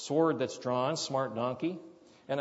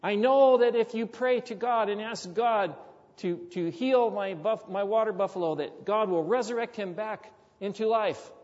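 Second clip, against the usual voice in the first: higher, louder, earnest plea for a miracle; pleading villager; 6 semitones higher, 4 dB louder than usual. Retold or imitated. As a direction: voice the villager begging, keen and hopeful. Villager pleads for his buffalo.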